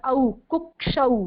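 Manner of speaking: कुक्षौ with the औ said as अ gliding into उ; slow, stretch the औ of कुक्षौ as अ-उ; pron of कुक्षौ. Shown as a demonstration. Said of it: "उसको अ और उ पढ़ते हैं". (कुक्षौ में औ को अ और उ मिलाकर)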